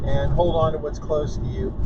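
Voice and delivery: ordinary talk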